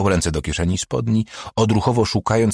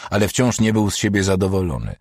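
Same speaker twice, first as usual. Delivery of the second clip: ordinary delivery, nothing marked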